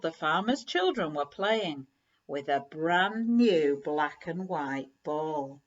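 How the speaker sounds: ordinary delivery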